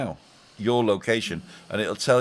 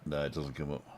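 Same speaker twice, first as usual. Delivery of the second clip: ordinary talk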